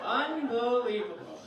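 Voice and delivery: slow, drawn-out exasperation; fuming out loud. Acted. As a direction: stretch the word in angry disbelief. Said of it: "he was fuming, I could tell".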